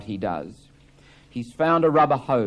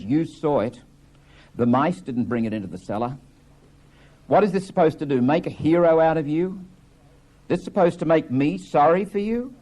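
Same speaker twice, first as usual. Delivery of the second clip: angry accusation; 6 semitones higher, 5 dB louder than usual. Acted. Biff confronts Willie's suicide lie.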